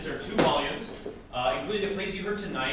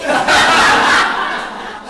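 loud unvoiced burst of laughter; sudden loud laugh at the joke; 13 semitones higher, 16 dB louder than usual. Real, not acted. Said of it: "accept the following applause".

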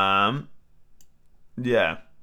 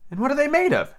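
high, eager question; great curiosity; 13 semitones higher than usual. A narrator's reading. Alice asking the Griffin, very curious. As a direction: ask it high-pitched and very curious. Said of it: "in a tone of great curiosity".

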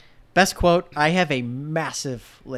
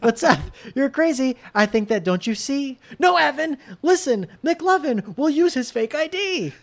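higher sung Evan voice, restarted; 10 semitones higher, 3 dB louder than usual. Pretend. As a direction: sing it again as Evan. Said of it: "you changed your voice for Evan".

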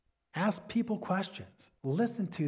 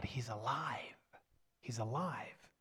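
breathy reverent whisper; 5 semitones lower, 12 dB quieter, slower than usual. Real, not acted. Preacher to congregation about the living God, whispered. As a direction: whisper it like a sacred secret.

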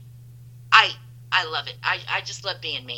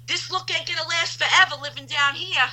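high-pitched sassy neighbor; hammed-up neighbor's put-down; imitated neighbor; 8 semitones higher than usual. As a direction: pitch it up, mock warning. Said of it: "She goes".

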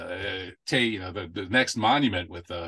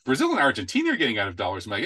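ordinary talk, nothing marked

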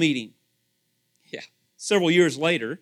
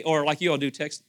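ordinary talk

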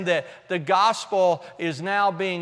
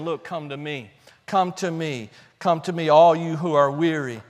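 low, softened, tender appeal; gentle invitation; 5 semitones lower than usual. Acted. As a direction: drop to a calm, low plea. Voices God's gentle invitation.

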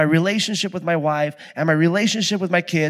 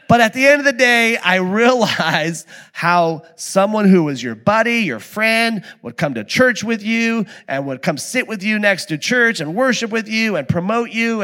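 usual speaking voice

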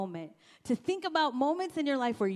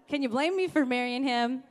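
normal talking voice

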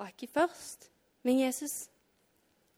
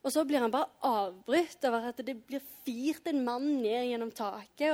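normal talking voice

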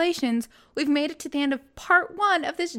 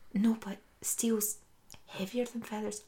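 hushed insisting; 9 dB quieter than usual. Retold or imitated. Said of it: "friend retort whispered".

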